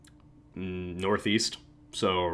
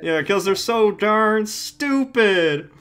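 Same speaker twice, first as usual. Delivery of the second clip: higher, slow, hammed-up Texan punchline; 12 semitones higher, 6 dB louder, much slower than usual. Delivering the squirrel joke punchline, hammed-up Texan drawl. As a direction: drawl out the punchline like a country comic.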